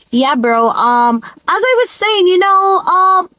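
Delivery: put-on careful foreign talk, pitched well up; mock non-dialect talk. Jamaican vlogger mocking having to drop her dialect, high put-on voice. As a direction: mimic polite small talk in a high, put-on voice. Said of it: "I'm not have time to be like".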